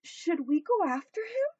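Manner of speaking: a timid small toy asking. Acted. Benji tells the hippo about cupcakes, high and unsure.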